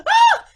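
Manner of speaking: high playful shriek; mother's playful mock scream. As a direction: shriek as if startled. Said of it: "she'd walk in and do the".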